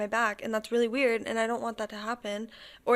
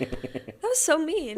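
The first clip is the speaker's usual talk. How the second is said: mock hurt; 6 semitones higher, much slower than usual. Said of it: "I was like".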